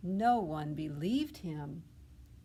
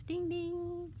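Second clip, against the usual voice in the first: bell sound; 12 semitones higher than usual. Acted. Bicycle bell voiced.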